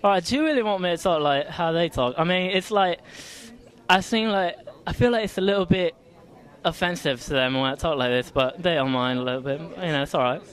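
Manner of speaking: put-on British accent for the fans. Shown as a demonstration. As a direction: talk like the Brits, playing it up on request. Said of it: "give us your best, best, uh, British accent".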